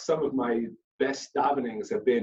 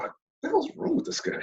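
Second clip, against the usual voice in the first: squeaky, much higher incredulous voice; mock bewildered onlooker. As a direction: mimic the stunned people staring at him. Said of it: "everyone around me looks at me like".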